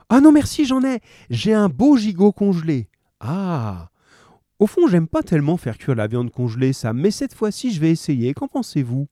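bright housewife. A narrator's reading. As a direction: brightly wave off the offer. Mary answers grocer.

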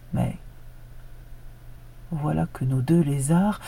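plain reading voice

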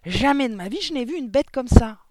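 high, very loud yell; desperate, indignant shout; full-lunged protest. A narrator's reading. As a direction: insist at the top of your voice. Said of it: "s'époumonna Mathilda".

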